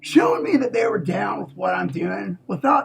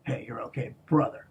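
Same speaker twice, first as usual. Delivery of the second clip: hushed, much softer, gently reassuring; 6 semitones lower, 7 dB quieter than usual. Retold or imitated. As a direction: murmur it like a comforting voice in your head. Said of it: "he fucking said".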